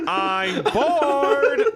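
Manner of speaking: hammed-up whine.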